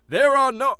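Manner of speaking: a loud revival preacher starting a sermon. Acted. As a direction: launch into the preacher character. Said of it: "he's sort of a preacher".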